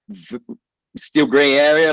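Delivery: usual speaking voice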